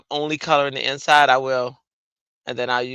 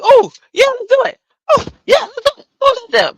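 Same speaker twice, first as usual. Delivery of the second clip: very high, loud, thrilled squeal; overexcited yes; 9 dB louder, faster than usual. Retold or imitated. Mocking her impulsive self to the audience, squealing very high. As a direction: squeal it like you can't wait to start. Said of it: "I'm like".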